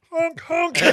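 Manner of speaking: high, playful honking noise; mock honk. Comic mocking the heckler with a high honk. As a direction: honk at him like a clown. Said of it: "And I went".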